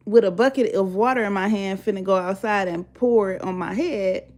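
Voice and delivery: normal talking voice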